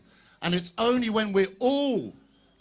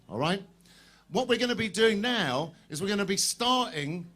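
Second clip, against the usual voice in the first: ordinary delivery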